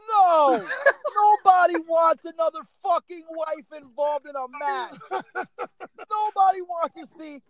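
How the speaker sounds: loud, high exasperated outburst; emphatic protest. Real, not acted. Wrestling fan rejects another wife storyline, emphatic protest. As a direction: cry out in protest and keep it loud.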